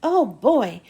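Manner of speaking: plain reading voice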